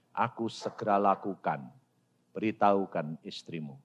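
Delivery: low, hushed divine command; solemn acted God. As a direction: speak low and soft, as God instructing. Voices God speaking to him.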